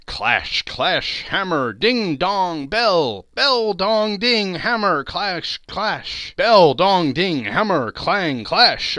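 exultant clanging recitation; pealing bells. A narrator's reading. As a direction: chime each word like a bell stroke. Narrator imitates pealing church bells.

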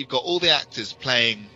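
ordinary talk

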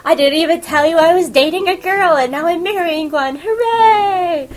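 high, loud, overjoyed announcement with a teary catch; gushing brother. Retold or imitated. Takahiro telling the boys about his fiancé, high and giddy. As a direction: gush the big news, bursting with glee.

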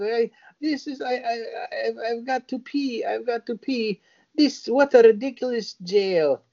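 high, whiny woman's voice; mock frantic woman, pitched high; high mock desperate woman. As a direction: squeal it out like she's bursting. Imitating the woman again.

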